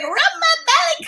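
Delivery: high-pitched voice for a pet dog; dog's voice. Acted. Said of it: "She's like".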